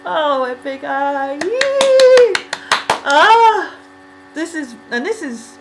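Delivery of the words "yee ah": excited squeal. Real, not acted. Cheering at Epic High.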